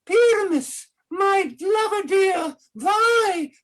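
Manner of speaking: high squeaky lady voice; tiny monster trying fierce; monstrous little voice. Acted. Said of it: "I'll speak in a monstrous little voice".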